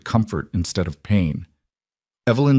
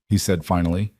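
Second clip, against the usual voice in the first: plain reading voice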